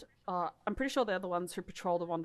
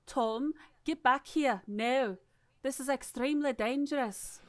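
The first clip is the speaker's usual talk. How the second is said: Clark's warning; 6 semitones higher, 5 dB louder than usual. Acted. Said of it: "did Clark's voice".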